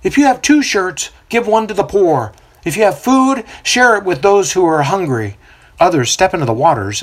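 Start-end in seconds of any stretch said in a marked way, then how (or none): 0.0-5.4 prophet proclaiming. A narrator's reading. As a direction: preach over the river to the crowd. Prophet addresses crowd.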